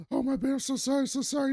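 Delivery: high, soft, flustered sorry; mock panicked apology. Ron apologizing to the fake heart attack guy, high and flustered. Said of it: "Ron's like".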